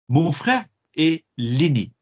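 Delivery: slow, careful French model reading. Shown as a demonstration. Teacher slowly modelling French for the class. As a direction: read it out slowly for students to copy. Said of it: "teacher models French slowly".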